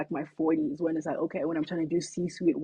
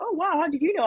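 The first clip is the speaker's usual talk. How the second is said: surprised; 10 semitones higher, faster than usual. Retold or imitated. Retold stranger amazed at guess.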